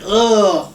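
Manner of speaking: retold squeamish "ugh"; imitated cringe of revulsion; disgusted groan. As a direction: groan as if watching something gruesome. Retells own squeamish reaction.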